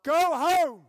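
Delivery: loud, high shout from the back; retold call from the crowd. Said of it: "I suddenly heard this voice call out".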